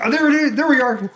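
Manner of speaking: high, delighted exclamation; excited relief. Real, not acted. Host seeing the stream picture return, high excited relief. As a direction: pipe up as it comes back.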